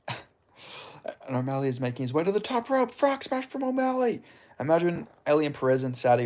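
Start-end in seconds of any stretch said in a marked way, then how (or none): 0.1-4.2 lightly voiced fight commentator calling the action; mock play-by-play. Streamer jokingly calls a fight like a commentator. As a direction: call the move like a ring announcer, rising on the smash.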